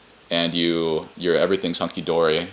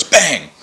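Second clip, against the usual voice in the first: mock gunshot; 5 semitones higher, 6 dB louder than usual. Gunshot voiced.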